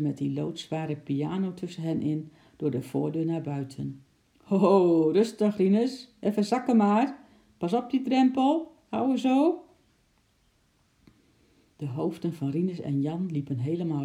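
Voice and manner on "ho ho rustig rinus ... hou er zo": louder, higher-pitched mover's call; calling out directions. Acted. Movers to each other, steering a piano through the door, calling out. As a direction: call out instructions while heaving a heavy load.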